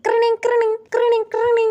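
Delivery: repeated ringing, mimicked by voice; imitated alarm clock. Shown as a demonstration. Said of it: "alarm അടിക്കുന്ന ശബ്ദം".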